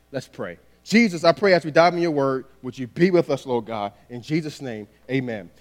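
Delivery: soft, low-pitched opening prayer; quiet, humble prayer before reading. Real, not acted. Leads a short prayer.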